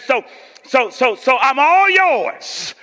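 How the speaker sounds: high, loud preacher, drawn out at the end; imitated preacher's slow, ringing surrender; Doctor Fred Sampson. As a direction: build from stammered starts into a long pulpit cry. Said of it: "That's how he talk".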